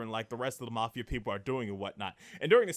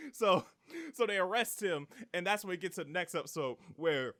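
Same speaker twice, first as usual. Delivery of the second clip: normal talking voice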